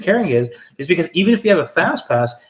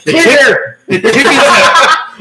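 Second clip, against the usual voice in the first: high, loud, laughing; 10 semitones higher, 8 dB louder, much slower than usual. Real, not acted. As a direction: push through the laugh. Laughing before movie quote.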